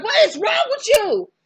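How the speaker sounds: very high, loud shout; shrieking outrage. Retold or imitated. Beth screams at Rio after he kidnaps her, a high piercing shout. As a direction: scream it at him in horror.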